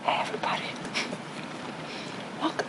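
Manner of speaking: breathy ASMR whisper. Pretend. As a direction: whisper right into the mic. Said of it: "I'm gonna whisper in your microphone now".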